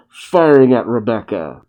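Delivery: ordinary delivery, nothing marked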